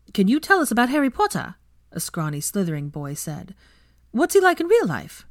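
put-on high, eager boy voice; scrawny Slytherin boy quizzing the professor; curious schoolboy. A narrator's reading. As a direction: press the teacher with nosy questions.

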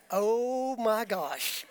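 slow, stretched-out mock dismay; drawn-out disbelief. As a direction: draw it out in comic disbelief. Reacting to trumpet pulled out.

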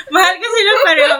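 high, fast and laughing through it; giggly gush. Pretend. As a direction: giggle it out like a giddy fan. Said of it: "Wow, nag-iba na yun".

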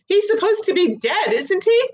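high, disbelieving question; can't believe it, pitch up; girl shocked the dead boy lives; astonished girl. Acted.

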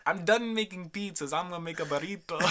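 a lightly voiced Italian pizza maker; hammy Italian accent. Friend riffs as an Italian pizza chef. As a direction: play a jokey Italian cook. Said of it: "Sounds like an Italian".

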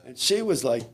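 normal talking voice

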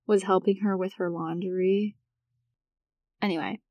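ordinary talk, nothing marked

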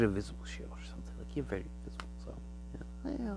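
soft fast whisper. Real, not acted. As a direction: hush it like a secret. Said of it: "whispered aside about invisibility".

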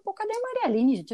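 high impatient call for a missing friend. Retold or imitated. Girl at her party asking where her friend is, high and impatient. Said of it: "Eu falei".

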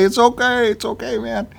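high-pitched imitation of a forgiving pal; easygoing friend reassuring. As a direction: wave off the apology, voice way up. Friend's reassurance, voiced by teller.

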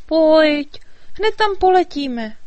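high, loud fairy voice hurrying him; eager fairy urging. A narrator's reading. Fairy urging the dragon to fly off, high loud call.

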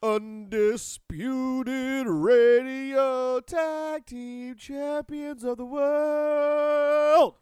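high, loud, drawn-out hype intro; announcer voice. Pretend. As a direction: sell it like a wrestling ring announcer. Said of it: "bad Queen cover band".

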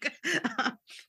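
a short high burst of real laughter; laughing. Real, not acted. Speaker laughing at her own same struggle, bright laugh. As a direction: laugh at yourself, amused.

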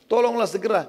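high acted plea; pleading victim. Wounded Abu Rafi' begging his supposed helper, acted plea.

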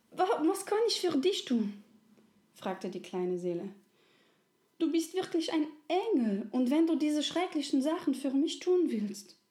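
put-on high, stammering childlike spirit; a small soul, wide-eyed and stumbling; little soul. Little soul offers help to the friendly soul, high and halting.

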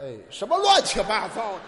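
loud protesting shout; mock outrage. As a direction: shout it down as nonsense. Partner protests nonsense answer.

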